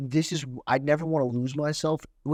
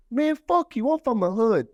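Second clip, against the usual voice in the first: much higher-pitched imitation of a hostile kid; mock defiant heckle; 11 semitones higher, 4 dB louder than usual. Pupil rejecting the guest speaker, mock defiant. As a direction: act out a pupil's brash dismissal.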